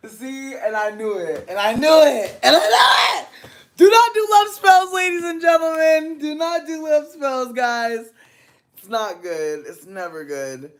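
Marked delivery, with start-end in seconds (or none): 0.1-3.3 rising from raised voice to high shout; louder and higher each time; building outcry. Real, not acted. Reacting that she knew it all along, building to a shout. Worked up, repeats herself more loudly. 3.8-8.1 high, loud plea that gradually eases down; shouted warning. Real, not acted. Warning viewers off love spells, loud and high.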